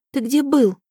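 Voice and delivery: high, urgent question; startled question. A narrator's reading. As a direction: ask in alarm. Heroine asks dragon.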